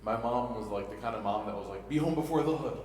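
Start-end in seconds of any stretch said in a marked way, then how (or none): 1.9-2.8 a touch of a stern mother, higher and louder; strict mom. Retold or imitated. Mom setting his curfew, mildly stern mother voice.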